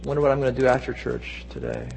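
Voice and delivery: low, quiet, indifferent mumble of assent; bored yawn. Acted. Churchgoer who has heard the story too often, bored and listless. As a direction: toss it off softly, as if stifling a yawn. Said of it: "we greet it with a yawn".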